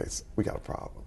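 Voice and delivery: hushed whisper to his son; whispered aside. Retold or imitated. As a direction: whisper it so nobody in the theater hears. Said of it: "I said".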